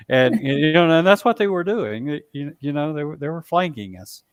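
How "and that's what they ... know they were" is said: plain reading voice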